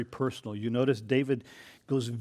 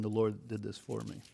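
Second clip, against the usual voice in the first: quiet humble thanks; 2 semitones lower, 7 dB quieter than usual. Retold or imitated. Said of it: "The emphasis is completely on".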